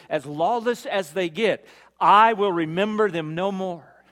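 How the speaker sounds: loud, high, ringing preacher's voice; sermon build, proclaiming loudly; preaching at full force. Acted. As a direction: drive it home to the congregation. Preaching the promise at climax.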